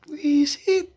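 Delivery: high laughing "Buisit"; laughing curse. Real, not acted. Vlogger laughing at a painful card, laughing curse. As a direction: squeal it out through a laugh.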